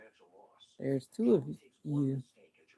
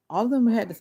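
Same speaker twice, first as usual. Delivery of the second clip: ordinary talk, nothing marked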